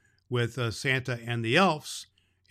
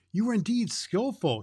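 proud lioness; 9 semitones higher than usual. A narrator's reading. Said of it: "she said proudly".

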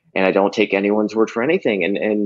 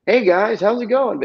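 bright, much higher friendly hello; cheery greeting; 11 semitones higher than usual. Retold or imitated. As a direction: play the kid breezily greeting the room. Stuttering kid suddenly speaks fluently.

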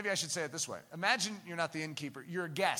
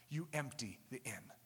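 hushed answer; 7 semitones lower, 11 dB quieter than usual. Real, not acted. Softly stating the answer.

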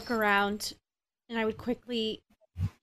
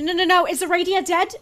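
panicked; 6 semitones higher, 7 dB louder, faster than usual. Acted. Player distraught.

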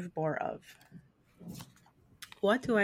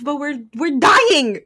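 dramatic wail; 8 semitones higher, 13 dB louder, slower than usual. Real, not acted. Laughing protest at own joke.

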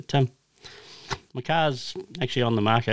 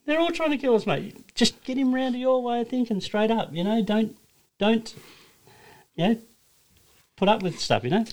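much higher, jokey calming tone; high mock reassurance; 12 semitones higher than usual. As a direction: play it up, laughing him off. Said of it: "I said".

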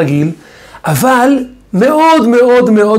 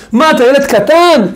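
higher, mocking scold of a child; 7 semitones higher, 3 dB louder than usual. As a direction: sneer at the kid as if he's a baby. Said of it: "אני אצעק על הילד". (לעג נוזף בילד)

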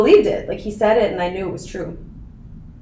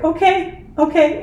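skeptical okay; 10 semitones higher, 7 dB louder, slower than usual. Retold or imitated. Reacting to husband's reassurance.

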